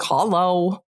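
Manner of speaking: dry sarcastic "Hello" like hallo; sarcastic "hello, you're in my way". Retold or imitated. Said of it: "We used to say that sarcastically".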